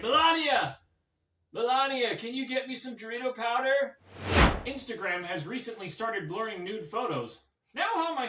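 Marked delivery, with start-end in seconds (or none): 0.0-4.0 loud, raised-pitch holler for someone; calling out. Acted. Comic as former president calling his wife, loud and needy. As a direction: yell the name, then the request.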